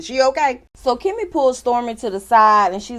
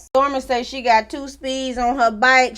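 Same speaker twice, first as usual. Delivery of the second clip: normal talking voice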